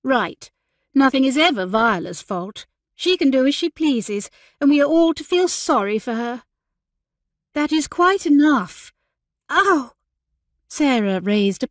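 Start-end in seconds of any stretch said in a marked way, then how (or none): 0.0-6.4 indignant huff, rising in pitch; aggrieved, huffy grumbling. Acted. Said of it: "Emily huffed". 7.5-8.9 high, firm, exasperated order; sharp big-sister rebuke; stern reprimand. Acted. Elder sister stops the quarrel. 9.5-9.9 high, short cry of pain; sudden ow from a pricked finger; startled high-pitched ouch; pained yelp. Acted.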